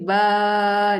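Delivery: "ba" with a prolonged aa; long vowel. Shown as a demonstration. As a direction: draw out the "a" in "ba". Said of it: "dia baca panjang".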